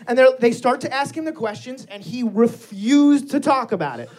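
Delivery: ordinary delivery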